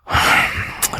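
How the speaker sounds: long breathy troubled sigh; heavy sigh. Acted. Husband brooding over his wife's secret, heavy sigh. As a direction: let out a worried sigh.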